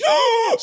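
sharp high gasping shriek. Retold or imitated. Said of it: "that's the noise he makes".

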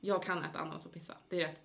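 ordinary talk, nothing marked

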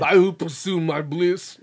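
hammed-up celebrity villain impression; Arnold. Villain declaring his life's goal, hammed-up Arnold impression. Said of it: "I do an Arnold impression".

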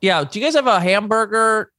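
high-pitched polite customer; role-play caller. Pretend. Customer ordering a hamburger by phone, high put-on voice. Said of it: "Okay, I'ma call in".

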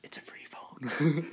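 slow whispered meme line. Pretend. Quoting the video's line to friends, slow whisper. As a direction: breathe it out slowly in a whisper. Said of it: "That's what I was thinking".

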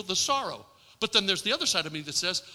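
normal talking voice

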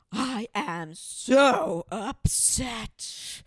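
drawn-out, very slow, loud wail of upset; mock dramatic upset. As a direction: ham up her melodramatic distress. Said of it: "And she goes".

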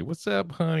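high, sweet Southern granny voice; sweet old Southern lady. Retold or imitated. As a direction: coo it like a doting Georgia grandma. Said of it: "Sweet old Southern lady".

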